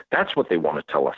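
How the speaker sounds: ordinary talk, nothing marked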